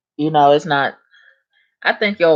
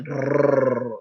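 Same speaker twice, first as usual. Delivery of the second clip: tongue-trilled "brrr"; rolled R; 3 semitones lower, 4 dB quieter than usual. Shown as a demonstration. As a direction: demonstrate a long rolled R sound. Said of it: "roll your R's".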